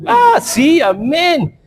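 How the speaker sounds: mock enthusiastic amen. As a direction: play the eager congregation cheering. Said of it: "y todos decimos hasta ahí". (amén entusiasta, agudo y fuerte, en burla)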